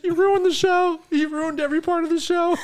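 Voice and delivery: loud, high laughing accusation; mock outrage. Co-host blames her for the flop, high mock outrage.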